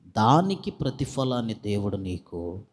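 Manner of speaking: fervent preaching. Acted. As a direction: preach it out to the congregation with fire. Preacher rises to full force. (ఉద్వేగంతో బిగ్గరగా ప్రసంగం)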